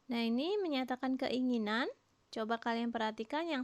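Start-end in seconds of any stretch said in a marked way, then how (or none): none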